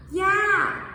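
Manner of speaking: "Yeah" high and loud, falling. Shown as a demonstration. Teacher comparing tones: high "Yeah". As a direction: exclaim "Yeah". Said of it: "It has that same thing".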